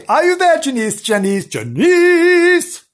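high, loud searching call. Pretend. Storyteller calls for Janice by the river, calling out. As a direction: holler the name, stretching the last one high.

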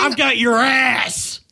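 slow, shouted mock menace; mock threat. To Joe, a slow shouted mock threat. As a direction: drag it out like a threat.

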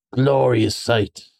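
slow, entranced murmur; dreamy murmur. A narrator's reading. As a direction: Toad lost in a trance, slowly. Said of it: "murmured the Toad".

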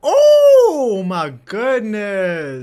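very loud, high, long drawn-out exclamation; outraged disbelief. Real, not acted. Friend hearing he ordered her to pick up the candy, outraged disbelief. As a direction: wail it out slowly in scandalized shock.

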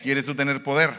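ordinary talk

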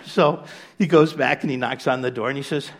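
normal talking voice

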